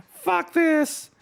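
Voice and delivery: high squeaky fuck this; squeaky exasperation. Real, not acted. Streamer giving up on game, high squeaky curse. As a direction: squeak it out in disgust.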